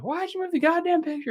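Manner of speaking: shrill-pitched mock yell of an angry wife. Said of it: "then she yells at him like".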